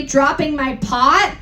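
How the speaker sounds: ordinary delivery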